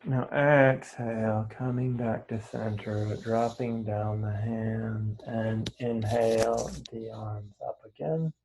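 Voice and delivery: slow, drawn-out exhale cue; guided breathwork. Real, not acted. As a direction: stretch the words out to pace their breath.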